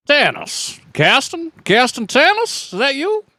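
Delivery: surprised greeting. Acted. Character greets old friend.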